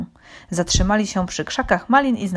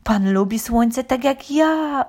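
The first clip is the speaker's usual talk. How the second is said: slow, pitched-up pleasure; pleased cat, drawn out and high; delighted cat; 6 semitones higher, slower than usual. A narrator's reading. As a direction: savour the shared liking. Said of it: "ucieszył się kot Mruczysław".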